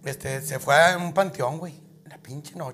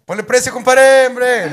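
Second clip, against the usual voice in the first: shouted, much louder demand; 9 semitones higher, 16 dB louder, slower than usual. Acted. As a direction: yell the haggle. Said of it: "le decía". (exigencia gritada)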